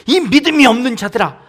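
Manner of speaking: a loud, scolding call with the first word hit hard; stern rebuke. Acted. Jesus scolding the fearful disciples, loud stern rebuke. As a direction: thunder it down at the faithless, slowing toward the end. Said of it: "하고 호통을 치십니다".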